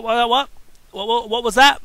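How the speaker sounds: loud, high mocking what what; taunting. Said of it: "Cain taunted".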